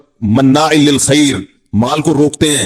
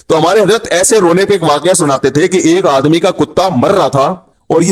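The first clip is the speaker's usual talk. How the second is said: ordinary delivery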